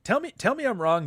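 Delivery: high, playful dare; teasing challenge. Real, not acted.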